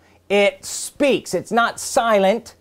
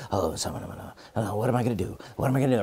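low, soft fretful muttering to yourself; worried murmur, much lower and softer; anxious mutter; 11 semitones lower, 10 dB quieter, much faster than usual. Shown as a demonstration. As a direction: wring your hands and mutter under your breath. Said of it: "You ever seen somebody mutter to themselves?".

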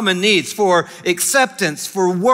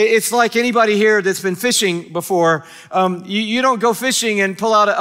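ordinary talk, nothing marked

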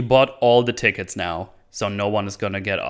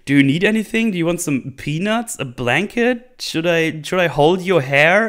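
high, loud sing-song mock politeness; a fussing waiter offering the dying woman peanuts, with mock politeness; mock caring host; 7 semitones higher, 5 dB louder than usual. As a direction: overdo the sweet, eager helpfulness. Mocking the killer's polite offers.

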